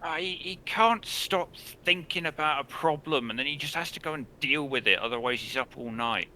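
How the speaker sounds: exasperated sister. Acted. Sister explaining her brother's habit to a guest, exasperated.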